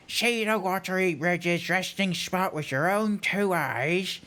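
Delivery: put-on old scholar reciting an ancient verse; weary character voice. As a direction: intone the riddle with teeth stuck out. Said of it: "the voice that I was able to come up with".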